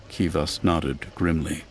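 plain reading voice

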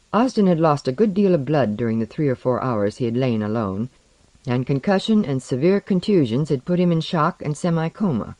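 ordinary delivery, nothing marked

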